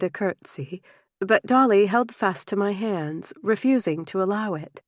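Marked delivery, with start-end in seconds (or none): none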